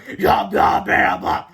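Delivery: loud monster chant; evil creature cry; evil toilets. A narrator's reading. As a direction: bellow it as a hungry creature mob. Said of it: "they cried".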